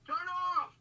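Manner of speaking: loud command at the phone; shouting. Real, not acted. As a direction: yell it at the phone. Yelling at the phone.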